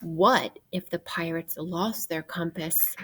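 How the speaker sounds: ordinary delivery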